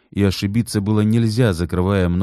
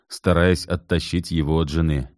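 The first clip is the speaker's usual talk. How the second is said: plain reading voice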